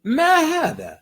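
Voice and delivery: high, rising questioning ما هذا with real curiosity; asking a question. Shown as a demonstration. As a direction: ask ما هذا as if truly puzzled. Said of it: "acting like I'm asking a question".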